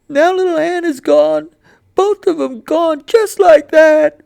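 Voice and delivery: put-on high, loud, breaking boy's voice; boy sobbing over his dead dogs; grieving boy. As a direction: cry it out to mama in protest. Grieving boy answers mother.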